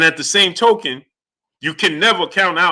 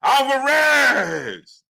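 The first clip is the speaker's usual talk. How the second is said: ring announcer; 2 semitones higher, slower than usual. Shown as a demonstration. Streamer showing how the announcer should have introduced Canelo. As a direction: stretch each title, then belt the name. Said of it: "I would have said like this".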